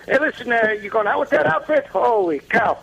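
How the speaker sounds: put-on bust his chops like an old-school father; impression of Mister Fitzgibbons. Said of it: "He goes".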